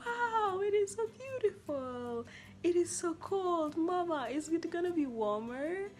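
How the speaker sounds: put-on high, loud, slow childlike awe; excited wonder. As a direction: marvel at the cold like a child. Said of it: "I was like".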